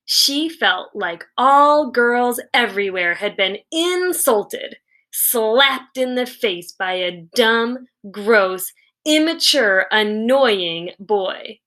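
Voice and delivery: ordinary delivery, nothing marked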